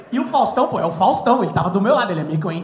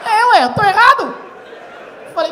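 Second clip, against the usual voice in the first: ordinary delivery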